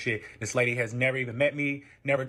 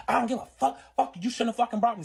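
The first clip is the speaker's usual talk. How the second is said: high, heated retold cursing; indignant, pitched-up outburst; angry defiance; 7 semitones higher than usual. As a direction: act out a furious brush-off. Retold angry reply in quarrel.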